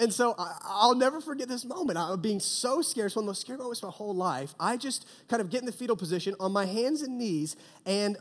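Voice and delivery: ordinary delivery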